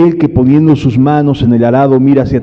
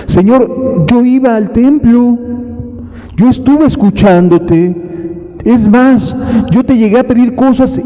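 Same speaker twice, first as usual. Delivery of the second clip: pleading believer; 7 semitones higher than usual. Retold or imitated. Latecomer pleading with the Lord.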